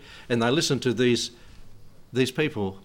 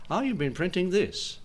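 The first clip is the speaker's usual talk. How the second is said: higher, feigned surprise; mock surprise; 7 semitones higher than usual. Ladies pretend surprise to the printer, higher mock voice. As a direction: act caught off guard, pretending. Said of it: "Pretending they don't know".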